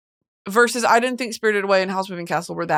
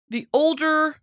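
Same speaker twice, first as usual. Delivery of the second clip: normal talking voice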